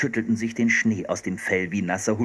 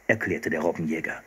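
plain reading voice

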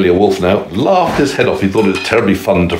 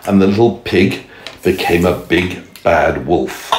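ordinary delivery, nothing marked

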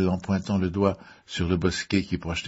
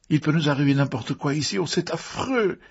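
frightened moaning; 8 semitones higher than usual. A narrator's reading. Mrs Rogers dreads what may happen next, frightened moaning. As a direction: wail it high in dread. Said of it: "gémit-elle".

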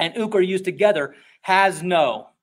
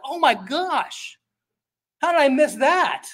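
slow, higher, drawn-out amazed gasp; mock amazement; 8 semitones higher, slower than usual. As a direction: act out being stunned by the insight. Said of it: "I go".

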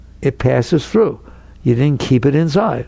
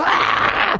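short breathy mock scream of fright; a quick whispered yelp of fear. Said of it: "they go".

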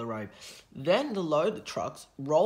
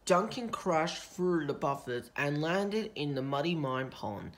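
ordinary delivery

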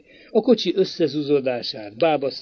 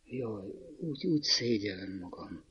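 much softer, trembling words dying away; faltering, fading; 4 semitones lower, 13 dB quieter than usual. A narrator's reading. Girl ashamed before her suitor, faltering and fading. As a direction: let it falter and fade out. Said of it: "rebegte elhalóan".

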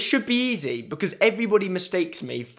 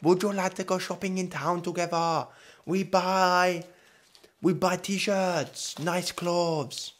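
halting, softer mock Chinese accent; 2 semitones higher, 4 dB quieter, slower than usual.

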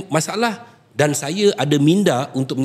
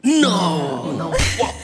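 playful protest; much slower than usual. Real, not acted. Guest jokingly denies it's about him.

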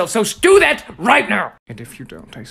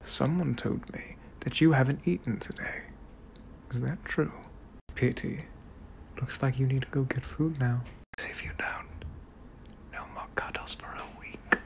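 soft, teasing reprimand fading to a whisper; British gentleman scolding; 7 semitones lower, 15 dB quieter than usual. Acted. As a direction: gently threaten your darling, ever quieter.

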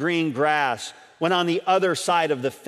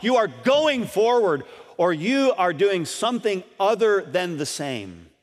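ordinary delivery

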